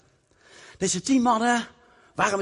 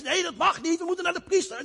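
high, insistent objection; objecting leper; 12 semitones higher than usual. Acted. Second leper argues they must see the priest, high insistent. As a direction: hold the rule firmly.

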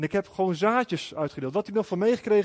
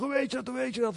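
higher, softer frantic repeated question; panicked man in psychosis; 6 semitones higher, 5 dB quieter, faster than usual. Retold or imitated. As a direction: voice him as confused and frightened, pitched up. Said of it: "in een soort van psychoseachtige toestand". (hoger en zachter, paniekerig en verward)

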